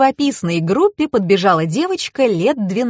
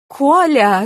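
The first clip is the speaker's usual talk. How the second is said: higher-pitched yell for the husband; calling out; 7 semitones higher, 4 dB louder than usual. A narrator's reading. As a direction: call out sharply to someone across the room. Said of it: "закричала женщина".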